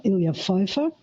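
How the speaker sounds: ordinary talk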